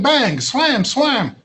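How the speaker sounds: bang and slam voiced high as sound effects; mock noises. Shown as a demonstration. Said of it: "report an event by going bang".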